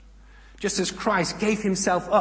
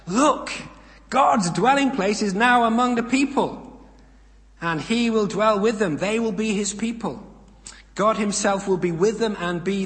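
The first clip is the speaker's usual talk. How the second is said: raised, higher voice from the throne; loud proclamation; 5 semitones higher, 4 dB louder than usual. Acted. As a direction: announce it loudly to all. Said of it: "I heard a loud voice from the throne say".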